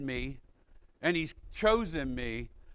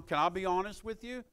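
usual speaking voice

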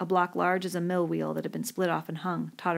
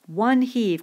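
loud, higher-pitched cry of triumph; exulting cry; 5 semitones higher, 8 dB louder than usual. A narrator's reading.

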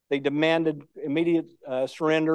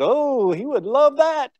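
higher, slow delighted reply; eager reply; 8 semitones higher, slower than usual. Retold or imitated. Veteran escort to the young lady, slow and higher-pitched delight. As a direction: answer slowly with lifted delight. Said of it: "And I said".

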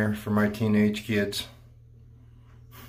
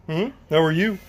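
higher, rising greeting to the dog; sing-song pet greeting; 8 semitones higher, 4 dB louder than usual. Real, not acted. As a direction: coo the question up to the pet.

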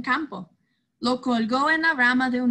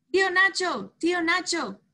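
higher, eager call across the yard; 8 semitones higher, 3 dB louder than usual. Acted. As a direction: call him from a distance. Boys calling out to uncle.

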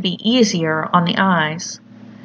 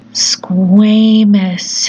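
slow, louder, oily creature voice; deep oily hiss; 6 dB louder than usual. A narrator's reading. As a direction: let it ooze out slow and slimy. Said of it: "hissed the baby in that deep, oily voice".

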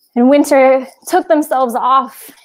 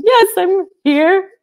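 high, slow and louder, voice choking on tears; tearful reply; 10 semitones higher, 5 dB louder, slower than usual. Acted. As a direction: answer weakly through crying, pitched up. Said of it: "looked up from the tears".

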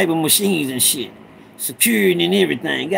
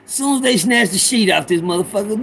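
ordinary talk

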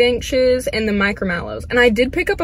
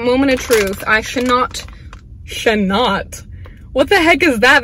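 normal talking voice

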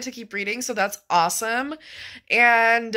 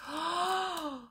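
gasping oh; 8 semitones higher, 9 dB quieter than usual. Real, not acted. Uncovering the edges.

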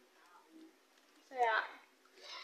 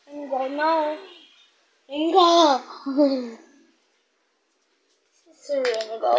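calling out loud; 12 dB louder than usual. Real, not acted. Calling the dog Ringo.

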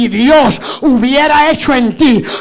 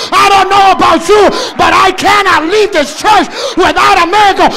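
higher, fervent crying-out sermon; preaching at full force; 4 semitones higher, 4 dB louder than usual. Acted. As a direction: drive the church to cry out.

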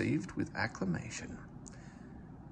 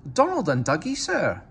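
louder, higher Scottish twin voice; sly Scottish engine excuse; Scottish accent; 8 semitones higher, 7 dB louder than usual. A narrator's reading. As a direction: innocent Scottish twins to the boss. Scottish twins naming themselves.

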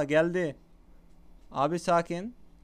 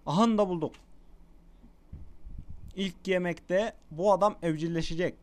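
ordinary delivery

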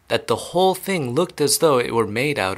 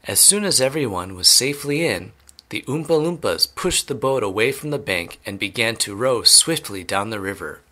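ordinary delivery, nothing marked

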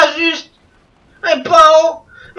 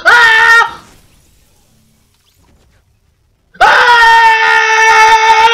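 loud, higher crying shout of defeat; mock anguished yell; 7 semitones higher, 4 dB louder than usual. To his viewers as he gets eliminated, loud hammed-up wail. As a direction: wail like you just got eliminated. Dying at end of match.

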